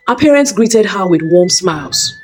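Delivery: ordinary delivery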